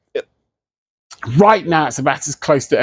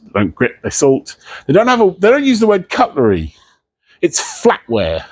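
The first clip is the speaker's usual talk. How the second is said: ordinary talk, nothing marked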